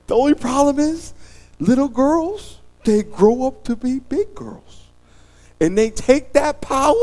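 high comic voice. Acted.

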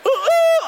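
very high, loud laughing ooh; high hooting. Pretend. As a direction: hoot shrilly through a laugh. Acting out scaring the coyote.

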